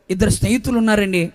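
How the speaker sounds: ordinary talk, nothing marked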